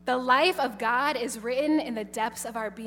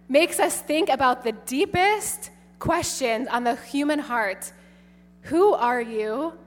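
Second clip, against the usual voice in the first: ordinary talk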